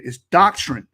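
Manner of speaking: "doctrine" said with a hard t, like tack-trin; mock mispronunciation; mimicking mispronounced doctrine. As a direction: copy his doctrine with a t sound. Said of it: "I think you're trying to say doctrine".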